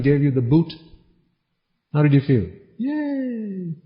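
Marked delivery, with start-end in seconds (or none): 2.8-3.7 high, soft sarcastic yay; a breathy little mock celebration; mock cheer. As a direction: pretend to be thrilled, ironically. Mock reaction to being dumped.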